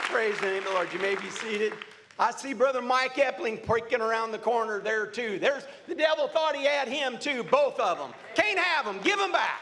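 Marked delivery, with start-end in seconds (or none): 2.2-9.6 building to high, punchy commands at the end; defiant preaching. Real, not acted. Preacher telling of the devil losing two believers, defiant preaching. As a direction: build up and claim them back.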